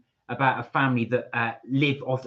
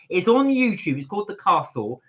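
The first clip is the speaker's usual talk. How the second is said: usual speaking voice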